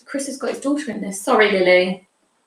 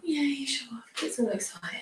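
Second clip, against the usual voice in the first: whisper; 2 semitones higher, 11 dB quieter than usual. Real, not acted. Teasing aside.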